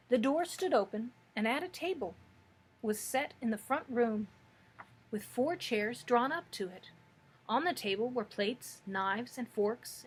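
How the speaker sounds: usual speaking voice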